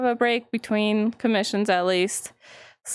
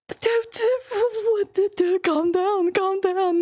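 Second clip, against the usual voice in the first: high, gasping, calm down; overexcited gasp then calm down; flustered; 8 semitones higher, much slower than usual. Real, not acted. Streamer flustered by a romance moment, gasping high.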